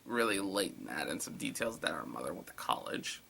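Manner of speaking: low hushed comment; sly whispered aside. Real, not acted. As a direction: mutter it to yourself. Aside muttered about the story.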